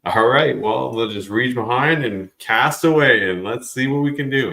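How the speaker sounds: eager mock self-talk; acted resolve. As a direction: raise the voice as if gearing up to cast. Acting out deciding to cast.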